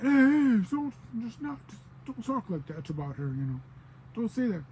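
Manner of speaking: high, loud protest; protesting man. Retold or imitated. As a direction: cut them off, alarmed. He objects to "our babe.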